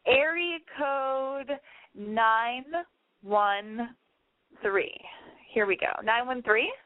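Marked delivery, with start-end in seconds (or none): 0.1-4.9 radio host announcing. Acted.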